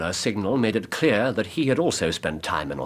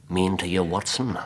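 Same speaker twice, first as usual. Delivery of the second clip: ordinary talk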